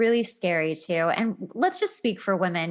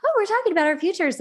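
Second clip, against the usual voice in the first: mocking quote; 9 semitones higher, much faster than usual. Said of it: "and was like".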